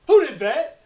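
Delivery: high, loud demand; angry coach. Retold or imitated. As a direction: yell it at the bus. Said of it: "he goes".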